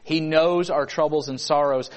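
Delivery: ordinary talk, nothing marked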